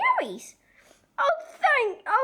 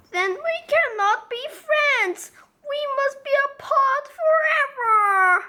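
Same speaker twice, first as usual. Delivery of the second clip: higher, crying mock tragedy; hammed-up sorrow; 11 semitones higher, 6 dB louder than usual. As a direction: play the farewell as melodrama. Gerald tragically ends friendship.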